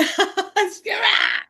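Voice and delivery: high laughing screech; yelling screech. Real, not acted. Speaker catches themself yelling, laughing screech. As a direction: let out a playful frustrated shriek. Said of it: "I'm yelling".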